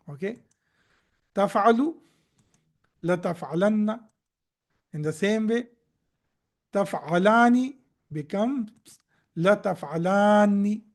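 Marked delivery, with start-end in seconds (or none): none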